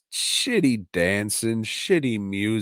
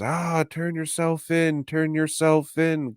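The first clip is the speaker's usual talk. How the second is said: conscience voice; 9 semitones higher than usual. Retold or imitated. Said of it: "The conscious is just".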